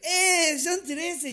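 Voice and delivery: high, loud fake-happy "son trece"; mock cheerful announcement. Parent told of thirteen babies, mock cheer. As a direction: announce it like great news, half-joking. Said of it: "Cuando dicen así".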